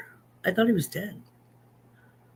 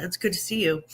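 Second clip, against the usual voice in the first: ordinary talk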